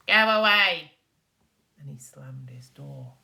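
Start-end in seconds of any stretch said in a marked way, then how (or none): 0.1-0.9 louder, higher snapped "go away"; rude dismissal. Acted. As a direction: shoo him off rudely. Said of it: "said Wee Willie Winkie".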